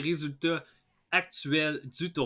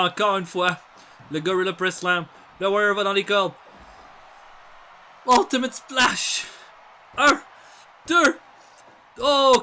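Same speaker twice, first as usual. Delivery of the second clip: shouting wrestling commentary at full tilt; excited play-by-play; 6 semitones higher, 6 dB louder than usual. Acted. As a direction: call every move like the crowd's on its feet.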